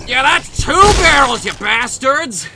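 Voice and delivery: loud, high-pitched taunting shout; full-volume combat taunt; triumphant battle shout. Pretend. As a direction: yell it at the enemy as you fire. Shouting at geckos he shoots.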